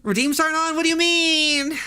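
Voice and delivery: high, startled disbelief; what do you mean, caught off guard; surprised. Real, not acted. Streamer hears from chat that redeems are off, surprised and high.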